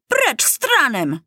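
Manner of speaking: slow, high-pitched proclamation; calling out. A narrator's reading. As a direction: cry it like a rallying slogan. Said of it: "zawołał".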